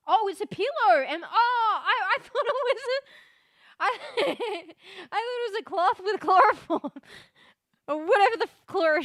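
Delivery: high, loud laughing disbelief. Real, not acted. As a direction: laugh through the words. Laughing at the pillow reveal.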